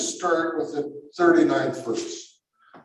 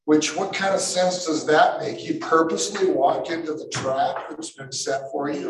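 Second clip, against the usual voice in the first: ordinary talk, nothing marked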